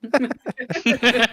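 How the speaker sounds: high, helpless laughter; real high-pitched laughing fit. Real, not acted. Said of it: "I'm just laughing at".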